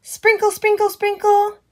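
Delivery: high sing-song sprinkle. Pretend. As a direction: lilt it up high like drops falling. Watering sound for child.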